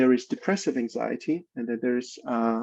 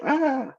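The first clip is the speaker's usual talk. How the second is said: high-pitched imitated baby yell; mock enraged baby cry; 11 semitones higher than usual. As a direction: wail "aah" like a furious baby. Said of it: "Baby's enraged".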